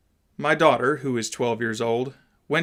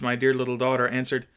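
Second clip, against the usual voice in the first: ordinary delivery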